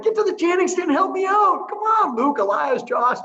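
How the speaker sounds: high, loud mock-exasperated dad; rallying call. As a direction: call the boys over to help. Acted dad rallying sons to chant.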